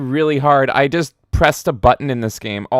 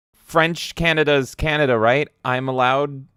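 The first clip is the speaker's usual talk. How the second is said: ordinary talk, nothing marked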